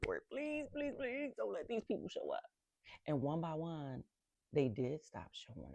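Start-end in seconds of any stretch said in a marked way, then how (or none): 0.1-2.4 soft, high-pitched mock pleading; hushed, desperate little retold prayer. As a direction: beg under your breath in a small high voice. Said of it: "but I was praying".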